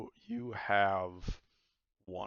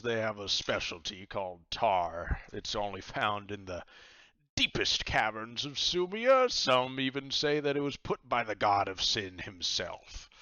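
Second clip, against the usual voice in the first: pirate god telling lore; 3 semitones higher, 6 dB louder than usual. Acted. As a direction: spin a legend like an old captain.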